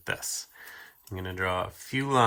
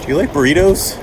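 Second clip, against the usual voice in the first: eager character voice; 6 semitones higher, 10 dB louder, faster than usual. Acted. Character asks eagerly, high.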